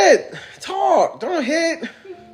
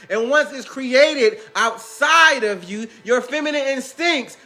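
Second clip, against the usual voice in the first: preachy guru sermon; 2 semitones higher than usual. Acted. Future self preaching divine wisdom, slow and solemn. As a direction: lay out the revelation slowly.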